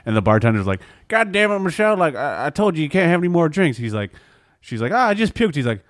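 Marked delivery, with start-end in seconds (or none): 1.1-3.8 exasperated bartender. Retold or imitated. Bartender scolding regular. 4.9-5.5 put-on drunk lady voice. Drunk lady quoted in story.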